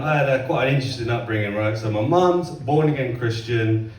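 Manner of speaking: usual speaking voice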